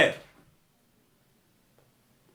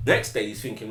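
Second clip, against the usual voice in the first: plain reading voice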